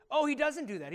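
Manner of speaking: ordinary talk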